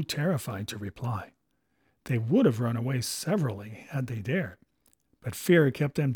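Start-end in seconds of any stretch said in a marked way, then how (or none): none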